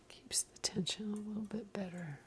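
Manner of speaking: hushed, barely voiced whisper. Real, not acted. Knitting teacher explaining the heel spring to viewers, whispering. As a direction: drop into an absent-minded whisper. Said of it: "I don't know why I'm whispering".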